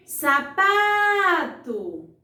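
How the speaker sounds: "Sapato" called out loud, PA held very long; calling from afar. Shown as a demonstration. Teacher calls a faraway word to find its stressed syllable, "Sapato" with long pa. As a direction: call "Sapato" as if it were far away, stretching pa. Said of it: "chamar a palavra como se ela estivesse bem longe".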